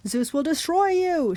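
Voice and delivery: high, slow vow of doom; threatening monster. A narrator's reading. Fury threatening Percy as she falls, high drawn-out threat. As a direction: draw out the threat, high-pitched. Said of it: "she promised".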